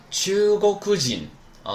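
秋沟哭基恩 slow, high and level, falling at the end; model pronunciation. Shown as a demonstration. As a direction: repeat 秋沟哭基恩 slowly for learners. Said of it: "再来一遍". (秋沟哭基恩放慢高读示范，结尾降调)

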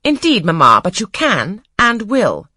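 haughty. A narrator's reading. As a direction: overrule her mother coolly. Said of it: "the haughty voice of Blanche".

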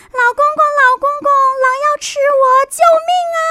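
high, loud panicked cry; a cat shrieking for grandpa's help; screaming for help. Acted. Cat screams to grandpa as the wolf pounces, high and loud. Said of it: "大花猫大叫起来".

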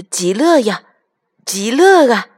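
lively and loud, savouring the words; gleeful child. A narrator's reading. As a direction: call it out with childlike delight. Child happily chants while scrubbing grandpa.

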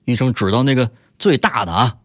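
ordinary talk

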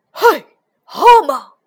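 huge booming shout; monster's roar. A narrator's reading. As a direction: bellow at the toads like a beast. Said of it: "怪兽咆哮道".

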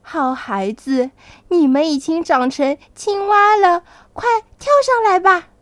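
storybook mother building to a loud, high urging; pleased Mother Frog. A narrator's reading. Mother Frog greets her grown children, building to high, loud urging. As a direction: start measured, then build to a quick, high invitation. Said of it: "笑着说".

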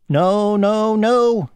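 high insistent housefly refusal; climbing high no no no; buzzy housefly. Acted.

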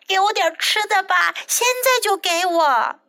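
high-pitched narrated shout. A narrator's reading. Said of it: "小鸟大喊道".

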